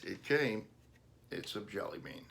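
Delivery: ordinary delivery, nothing marked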